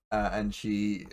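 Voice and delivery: ordinary delivery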